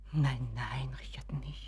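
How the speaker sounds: hushed, breathless no, no; frightened whisper. Acted. Mother whispers to her son Richard, frightened whisper. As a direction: whisper it so nobody hears.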